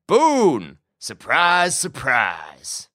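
high, drawn-out mocking welcome; smirking American cowboy. A narrator's reading. Prisoner greets Boone from the couch, smirking. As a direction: greet an old rival with mock delight.

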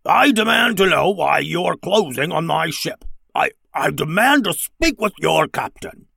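demanding frog-like alien captain; slimy, grumbly creature. A narrator's reading. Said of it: "in a slimy, grumbly voice".

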